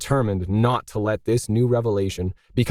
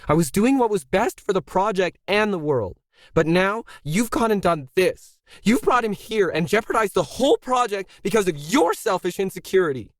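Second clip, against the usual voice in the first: much higher, strained outburst; control snapping; 9 semitones higher than usual. A narrator's reading. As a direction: let the restraint crack into fury. Zoe's control finally breaks.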